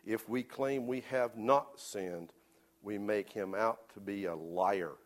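slow, stressed scripture reading; preacher reading the key verse to the congregation, slowly; slow pointed reading. Shown as a demonstration. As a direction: lean on NOT, then slow down. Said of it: "it says".

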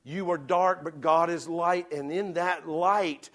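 preacher swelling toward salvation; rising preaching. Acted. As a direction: build to the altar call. Building preaching climax.

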